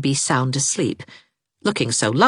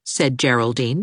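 ordinary delivery

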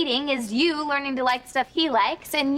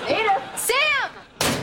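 high, raised shout of names; scolding shout; 7 semitones higher, much slower than usual. Acted. Scolding friends for hitting balls at players, shout. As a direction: call them out sharply.

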